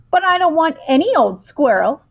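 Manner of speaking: high, loud spoiled tantrum; spoiled girl refusing, shouting high and loud; bratty shout. A narrator's reading. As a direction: shout it stamping your foot. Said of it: "Veruca shouted".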